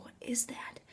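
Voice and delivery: very soft whispered question; frightened whisper. Retold or imitated. Teller in bed hearing strange sounds, frightened whisper. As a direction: whisper it as if listening.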